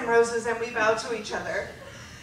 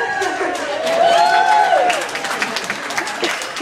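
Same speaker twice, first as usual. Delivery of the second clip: high burst of laughter; 5 dB louder than usual. Real, not acted. Recalling the assembly.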